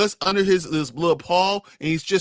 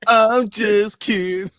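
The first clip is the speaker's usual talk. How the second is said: loud, drawn-out, high laughing kidding; teasing and cracking up; laughing tease; 7 semitones higher, 5 dB louder, much slower than usual. Real, not acted. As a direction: say it slowly through laughter. Teasing Brian about Barbie crying.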